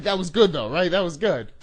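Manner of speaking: high, loud self-congratulation; pleased with the prank. Retold or imitated. Prankster seeks agreement on his joke, high and excited. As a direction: crow over your own joke.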